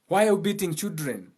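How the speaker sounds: normal talking voice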